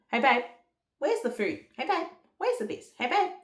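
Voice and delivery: high-pitched mock calling out; pestering husband. Husband asking wife where things are, high mock pestering. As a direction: pipe up repeatedly, imitating a needy partner.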